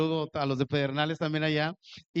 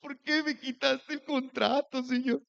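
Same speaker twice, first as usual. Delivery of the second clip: mock whimpering plea; 5 semitones higher than usual. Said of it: "bien humilladito a la casa a chillar".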